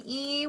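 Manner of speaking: E held long as a pure "ee"; long E sound. Shown as a demonstration. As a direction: draw out E as a clear teaching sound. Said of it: "also says the E sound".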